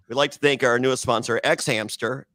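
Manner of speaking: quick mock sponsor announcement; mock ad read. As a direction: rattle it off like an ad read.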